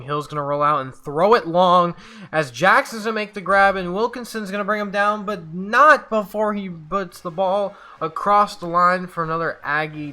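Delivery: excited play call. Real, not acted. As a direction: build high and loud as the long pass lands. Calls a long touchdown pass.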